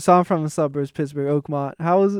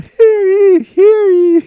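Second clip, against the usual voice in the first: high, loud herald's call; town crier; 11 dB louder, much slower than usual. Pretend. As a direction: proclaim to the whole town. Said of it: "I have to do that while I'm walking around town".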